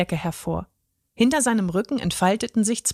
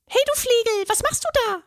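very high, cross little fairy voice; squeaky tiny fairy scolding a burglar; high angry fairy voice; faster than usual. A narrator's reading. Tiny fairy scolds the troll in her bed, high and cross. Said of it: "ertönte eine hohe, erboste Stimme".

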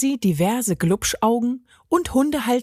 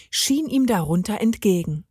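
plain reading voice

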